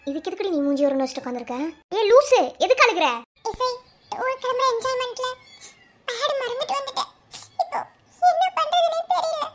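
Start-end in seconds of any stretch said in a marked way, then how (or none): 2.0-3.1 raised, high exclamation; startled scolding. Acted. Startled scolding of crying friend. 3.5-9.4 high-pitched tearful wail; crying through the words. Acted. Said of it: "இதுக்கு ஏன்டி அழுதுட்டு இருக்க?".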